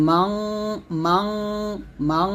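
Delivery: each মাং with a heavy, rounded nasal hum; thick ghunnah. Shown as a demonstration. Teacher repeating the thick sound, heavy held nasal on মাং. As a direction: hold every মাং long and thick. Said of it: "এটা হলো মোটা আওয়াজ".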